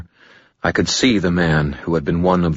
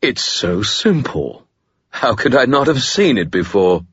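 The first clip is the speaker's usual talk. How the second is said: dusty, amused; 6 semitones higher than usual. A narrator's reading. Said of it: "his voice dusty and amused".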